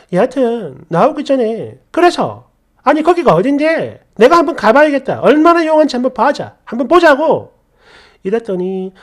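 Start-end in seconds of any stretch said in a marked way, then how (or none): 1.9-7.5 loud, higher, sneering demand; sarcastic challenge. Retold or imitated. Wife demands the shaman's address, loud sarcastic challenge. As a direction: dare her to name the place.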